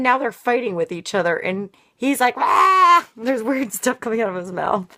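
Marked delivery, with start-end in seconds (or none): 2.4-3.1 yell. Retold or imitated. He is fighting with her.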